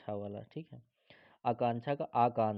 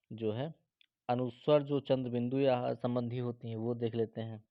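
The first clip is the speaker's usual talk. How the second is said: ordinary talk, nothing marked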